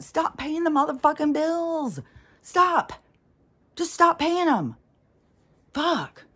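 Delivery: high, loud furious rant; angry outburst. Real, not acted. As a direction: yell it at them in real anger. Ranting about paying bills.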